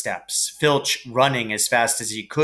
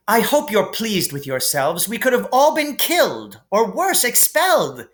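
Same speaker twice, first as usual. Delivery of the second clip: higher, indignant scolding; 8 semitones higher than usual. Acted. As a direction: lecture them, outraged. Hermione scolds the boys.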